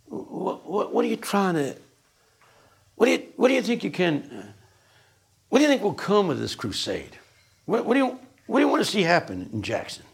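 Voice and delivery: high, stammering mock-bumbling questions; imitating a halting, frightened sharecropper; John Perkins impression. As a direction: keep restarting, as if scared to ask. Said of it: "he talks like this".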